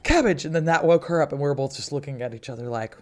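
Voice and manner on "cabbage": calling the cat's name. Retold or imitated. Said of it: "And I was like".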